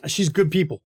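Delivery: ordinary talk, nothing marked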